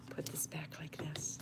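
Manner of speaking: careful whisper. Real, not acted. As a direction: whisper while moving it gently. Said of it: "I'm whispering because I'm afraid my camera's gonna fall".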